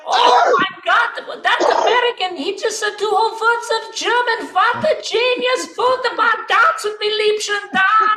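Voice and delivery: put-on high, loud, gushing German accent; hammed-up German amazement at full pitch. Germans gush over American tourist, excited German accent. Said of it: "the Germans would be like".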